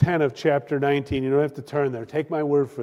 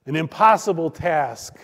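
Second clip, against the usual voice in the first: usual speaking voice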